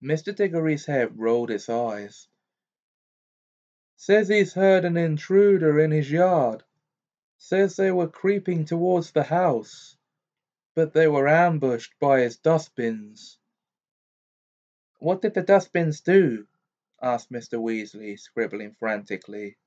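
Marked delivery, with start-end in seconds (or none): 4.0-13.4 higher, louder brisk wizard; brisk, pressing news; Amos Diggory. A narrator's reading. Diggory's head speaking. 15.0-16.5 higher, louder eager question; Mr Weasley. A narrator's reading. Mr Weasley asking.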